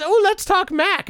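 high, slow teasing announcer voice; teasing Tim Cook. Retold or imitated. Tim Cook teasing the dads about the Mac. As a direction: dangle it like a tease. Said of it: "He says".

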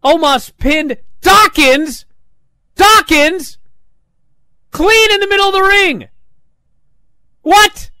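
high, loud, building disbelief; can't believe what just happened; shocked outburst. Real, not acted. Reviewer stunned by the clean pin finish, rising shout. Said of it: "shocked reveal of finish".

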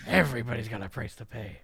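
low, put-on scripture voice; mock Lord. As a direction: drop low for a mock-solemn decree. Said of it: "And the Lord said".

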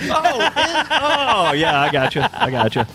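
normal talking voice

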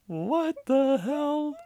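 high, slow, stunned; drawn out in shock; shocked disbelief. Real, not acted. Shocked outburst at quote.

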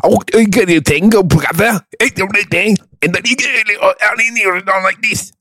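high, garbled mock Mancunian; exaggerated northern English impression; Manchester accent. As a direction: do a barely intelligible Manchester accent. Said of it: "You get up to Manchester".

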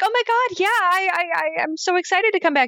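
high, gushing, rushed excitement; overexcited gushing. Retold or imitated. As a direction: gush like an eager actress. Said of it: "she starts to be like".

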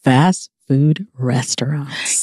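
slow, breathy reveal of the topic; whisper. Acted. Whispering the topic to her sister. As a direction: drop to a slow secret whisper.

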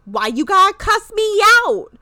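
high, loud, hammed-up protest; mock indignant outburst. Acting out her complaint to him, mock indignant and loud. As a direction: burst out in mock outrage.

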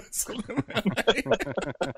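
high, giggly cover-up; laughing fake excuse. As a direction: laugh off the tears. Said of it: "I was like".

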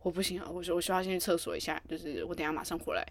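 weak, urgent excuse. Retold or imitated. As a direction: mumble it low like you're about to burst. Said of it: "然后我就说".

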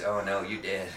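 put-on soft southern accent. Hospital preacher tells patient he's dead in a soft Southern accent. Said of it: "that fucking southern accent".

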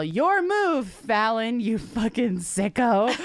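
a taunting heckle that ends slow; mock yell. Heckling James Corden's song ending, high taunting yell that slows. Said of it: "you can yell".

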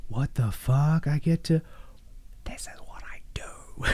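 fading into a slow whisper of wonder; awed whisper. Retold or imitated. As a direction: let it die into a stunned whisper. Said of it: "like you said".

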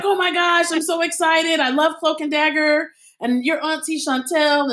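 mimicking an overjoyed fan meeting the actress; excited fan. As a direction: gush like a thrilled fan who just spotted her. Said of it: "he was like".

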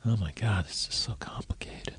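a hushed, overwhelmed whisper; mock whisper. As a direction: whisper it like it's all too much. Mock overwhelm at social media.

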